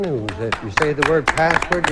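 ordinary talk

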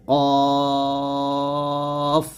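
কফ held long in a recitation tune; drawn-out Arabic qaf. Shown as a demonstration. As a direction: sing কফ out like Quran reading. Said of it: "এরকম উচ্চারণ করতে হবে". (সুর করে টেনে কফ)

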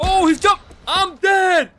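high, loud panicked shout; panicked yell. Real, not acted. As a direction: yell in sudden gamer panic. Sudden attack in game.

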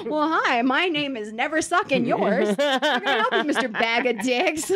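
mock polite greeting while laughing; playing the agent, laughing through the insult. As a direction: try to stay polite but crack up. Joking role-play while laughing.

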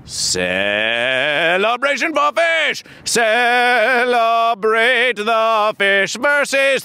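very slow, drawn-out cheer; slow celebration. Acted. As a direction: stretch every word grandly. Host drawn-out fish celebration.

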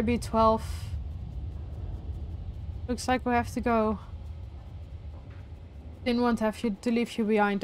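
softly, regretfully let a companion go; sorrowful farewell. Real, not acted. Player apologising to B twelve for leaving, quiet and sorrowful.

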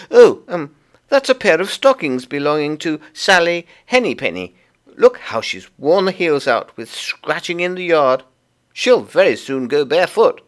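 high, loud little creature voice; busy Tiggywinkle. Acted. Tiggywinkle explains the washing to Lucy, high and busy. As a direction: chatter away as a small fussy laundress.

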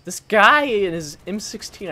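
loud, slow, exasperated shout at the screen; frustrated yell. Real, not acted. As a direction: yell it out at the guy who keeps killing you. Frustrated yelling at enemy player.

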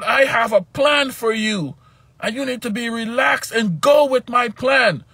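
high, loud stern shout; angry God commanding. Retold or imitated. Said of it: "and he was mad".

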